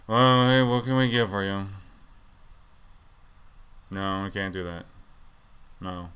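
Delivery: mumbled, lifeless monotone; depressed drive-through worker. Acted. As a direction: slur it like a worker who has given up. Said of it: "sound like they're, um, almost suicidal".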